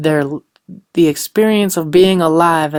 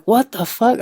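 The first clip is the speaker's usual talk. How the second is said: mock shocked, pitched higher; imagine hearing your daughter say that; shocked reaction; 6 semitones higher than usual. Mother reacting to her daughter's gloomy answer, mock shocked. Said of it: "I would be like".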